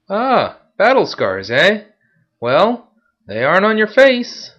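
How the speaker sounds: high, loud, slow sneering guard; guard taunting the veteran, loud and high; mocking guard. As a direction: jeer at him as the guard. Guard mocks Marcus.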